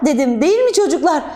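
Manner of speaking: ordinary delivery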